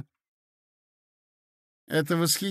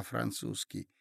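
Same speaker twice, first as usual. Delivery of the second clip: plain reading voice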